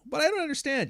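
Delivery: very high, whining "I don't understand"; mock whiny confusion. Mocking someone pretending not to understand, high whine. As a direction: whine like a dense stalling person. Imitating stalling person.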